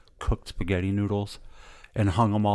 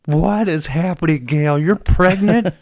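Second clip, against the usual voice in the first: high, loud, panicked disbelief; husband loudly and frantically demanding answers, high and fast; frantic questioning; 10 semitones higher, 7 dB louder than usual. Retold or imitated. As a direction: fire questions at your wife in a panic. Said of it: "I call my wife".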